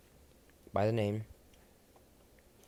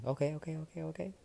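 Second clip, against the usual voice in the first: flustered okays; 8 semitones higher, faster than usual. Acted. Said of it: "Momo saying".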